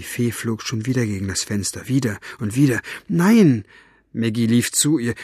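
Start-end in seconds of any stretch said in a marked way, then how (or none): 3.1-3.6 high, louder cry of protest; alarmed cry. Acted. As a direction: cry out to stop someone. Girl stops fairy at window.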